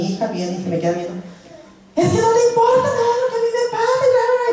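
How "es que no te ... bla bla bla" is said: put-on much higher, fast, complaining teen voice; angry teen daughter. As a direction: act out the daughter snapping at mom. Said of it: "Empezó a gritarme". (imitando a la hija adolescente enojada)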